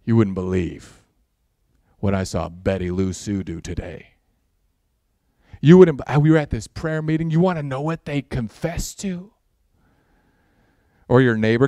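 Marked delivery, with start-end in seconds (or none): 0.0-9.3 low hushed gossip; gossiping whisper. Retold or imitated. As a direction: dish the dirt in a hush. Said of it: "talking all the gossip to your spouse".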